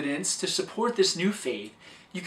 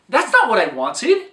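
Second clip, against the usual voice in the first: louder, higher mock complaint; 4 semitones higher, 7 dB louder than usual. Customer complains to waiter about wrong pizza, mock-loud. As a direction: play the annoyed customer, raising the voice.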